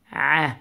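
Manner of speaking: ع squeezed from mid-throat; throat ain. Shown as a demonstration. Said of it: "from the middle of your throat".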